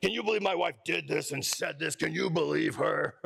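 put-on dopey complaining guy voice, dragging at the end; hammed-up husband caricature; mocking griping husband. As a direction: make fun of a husband griping to his buddies. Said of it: "I make fun of the guys too".

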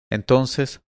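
ordinary delivery

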